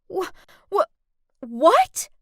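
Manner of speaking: high stammering disbelief; stunned, tripping over words; shocked stammer. Acted.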